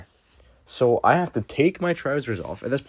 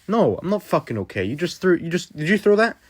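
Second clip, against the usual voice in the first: angry; 8 semitones higher than usual. Retold or imitated. Confronting Jake who threw it.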